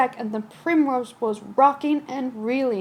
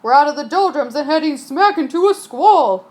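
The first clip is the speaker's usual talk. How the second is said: louder, urgent captain's call; urgent warning; 7 dB louder than usual. Acted. Captain warning crew of a squall. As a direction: warn them loud and fast.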